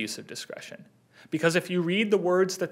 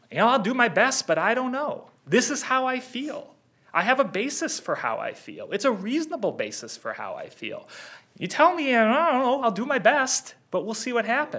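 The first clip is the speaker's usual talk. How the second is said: unsure, higher, searching tone; hesitant inflections; 8 semitones higher than usual. Retold or imitated. Lawyer voicing juror's doubt for the judges, unsure higher inflections. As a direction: voice the juror's wavering doubt, pitched up. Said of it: "Counsel, you're giving inflections".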